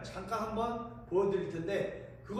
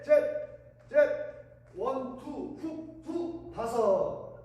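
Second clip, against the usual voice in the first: rhythmic shouted workout calls; boxing coach calls; 6 semitones higher, 4 dB louder, much slower than usual. Shown as a demonstration. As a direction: count the punches like a coach.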